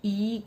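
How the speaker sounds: ई pronounced as a held, pressed "eee"; long stressed ee. Shown as a demonstration. Teacher recaps बड़ी की मात्रा with जोर, long stressed ee. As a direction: put some weight on ई and lengthen it. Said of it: "जोर दे के थोड़ा बोला जाता है".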